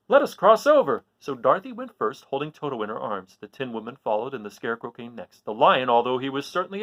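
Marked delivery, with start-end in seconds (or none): 0.1-1.1 high, loud hurrying call; urgent call. Acted. The Scarecrow hurries the friends across, high urgent call. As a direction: push them across fast. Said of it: "cried the Scarecrow".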